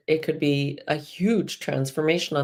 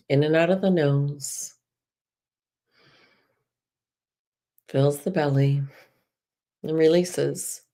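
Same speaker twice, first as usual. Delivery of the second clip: guided meditation. Acted. Guide leading listeners through breath and release, soothing. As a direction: ease them gently into the womb visualisation.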